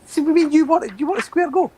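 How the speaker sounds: high, loud Glasgow hard-man challenge; squaring up in a Glasgow street accent. Acted. Mock threat over Star Wars.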